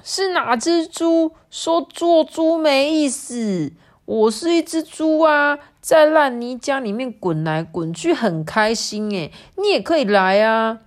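high, loud story-character voice; playful piglet beckoning a friend; cheerful pig calling out. Acted. Another pig calls from the mud.

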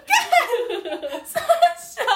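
very high, loud, laughing outburst of disbelief; shocked exclamation. Retold or imitated. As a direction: yelp it like you can't believe it. Her shocked reaction, retold.

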